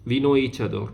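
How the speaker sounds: usual speaking voice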